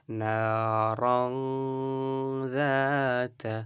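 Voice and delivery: নারাং slow with the ং hummed through the nose; nasal ghunnah demo. Shown as a demonstration. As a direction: hold নারাং nasally for one alif. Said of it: "এভাবে উচ্চারণ করতে হবে". (নারাং এ গুন্নাহ করে পড়া)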